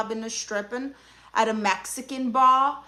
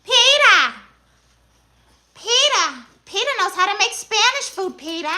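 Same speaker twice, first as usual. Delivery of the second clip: much higher, loud nasal-free cartoon mom calling Peter; Lois Griffin; 9 semitones higher, 7 dB louder than usual. Answering a viewer who says she sounds like Lois, high cartoon call. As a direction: yell Peter like a sitcom wife. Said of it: "You sound like Lois Griffin".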